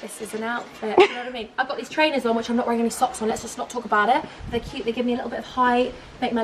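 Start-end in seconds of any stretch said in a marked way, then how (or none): 0.0-1.0 much softer monotone self-imitation; mock a dull outfit intro, very quietly; hushed, deadpan presenting; unchanged usual self. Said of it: "otherwise it's just me like".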